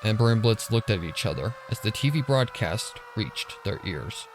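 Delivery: normal talking voice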